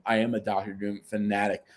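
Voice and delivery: normal talking voice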